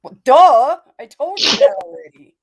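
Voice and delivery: loud, high "well, duh!"; sassy duh. Real, not acted. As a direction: bark it out as if it's obvious. Teasing a friend over tester.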